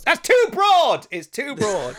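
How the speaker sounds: high, loud cry of exasperation, then easing; mock exasperated outburst. Actor retelling an argument over which Welsh accent, mock exasperated. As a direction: burst out in exaggerated protest, first line drawn out.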